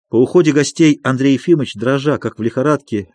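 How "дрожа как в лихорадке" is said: ordinary delivery, nothing marked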